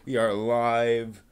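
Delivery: usual speaking voice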